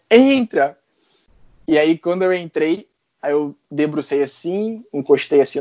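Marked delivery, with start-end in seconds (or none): 0.1-0.8 put-on "Entra." called high in a Paulista accent; Paulista accent again. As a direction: repeat "Entra." the São Paulo way. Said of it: "Paulista, né?".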